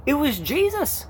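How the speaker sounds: high, exclaiming Southern twang. Shown as a demonstration. Preacher announcing Jesus as the sign, Southern twang. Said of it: "went on a southern twang".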